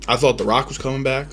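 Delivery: normal talking voice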